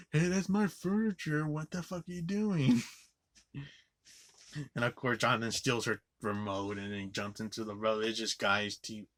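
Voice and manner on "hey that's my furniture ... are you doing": high-pitched mock outrage; hammed-up indignant housewife, pitched way up; imitating mad lady. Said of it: "getting all fucking mad".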